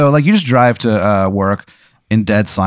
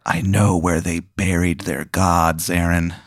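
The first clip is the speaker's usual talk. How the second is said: low, slow eerie raven voice; creepy low crow whisper; ominous crow; 5 semitones lower, 5 dB quieter, slower than usual. Acted. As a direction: drop it low and slow like a sinister bird. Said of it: "he just goes".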